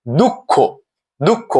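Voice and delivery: দুঃখ as "dukkho", held double k; pronunciation demo. Shown as a demonstration. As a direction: say দুঃখ with a rising final "o". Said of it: "ও রূপে উচ্চারণ হয়".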